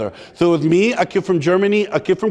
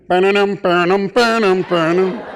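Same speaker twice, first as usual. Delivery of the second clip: seventies music. Retold or imitated. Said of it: "you swore you heard seventies music".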